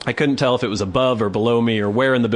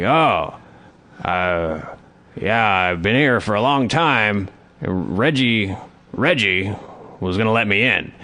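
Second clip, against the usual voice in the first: mumbling homeless man; 3 semitones lower, slower than usual. Retold or imitated.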